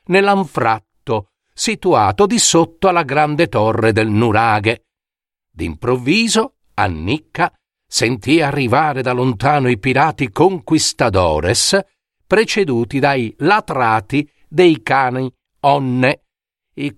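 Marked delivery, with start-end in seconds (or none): none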